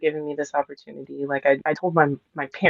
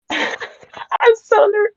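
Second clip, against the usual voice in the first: voice breaking; much slower than usual. Real, not acted. Nervous guest on a podcast, voice cracking. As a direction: voice cracking high with nerves. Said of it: "can you hear me break down?".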